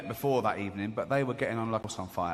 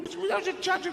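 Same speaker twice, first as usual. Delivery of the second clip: high mock voice. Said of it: "he was going".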